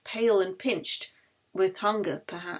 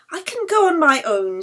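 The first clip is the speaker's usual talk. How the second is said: higher, louder Yeli offering; Yeli, eager; 7 semitones higher, 6 dB louder than usual. Acted. Yeli tells coughing Anna she can go alone, eager. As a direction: brightly insist you can manage alone.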